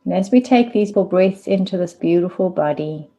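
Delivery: unhurried meditation guide, long pauses between phrases; spacious, measured body-awareness guidance; guided meditation. Real, not acted. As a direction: lead them gently into stillness, leaving space after each instruction.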